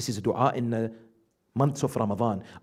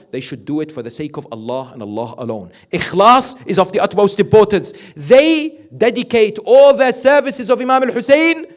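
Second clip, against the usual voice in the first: loud, higher preaching voice, driving each point home; fervent preaching; 10 semitones higher, 16 dB louder than usual. Acted. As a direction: preach it out to the congregation. Preaching at full force.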